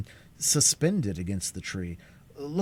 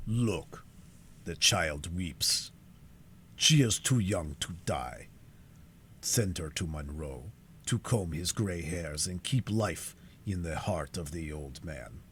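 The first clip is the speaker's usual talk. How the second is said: lower, slow, taunting captor's voice; taunting irony; 4 semitones lower, 4 dB quieter, slower than usual. A narrator's reading. Magua taunting Cora about her weeping sister, slow and mocking. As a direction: sneer it out softly, savouring the threat. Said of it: "pointing with taunting, taunting irony".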